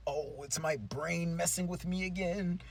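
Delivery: lightly voiced, drawn-out weary muttering to himself; troubled professor. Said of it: "he's like".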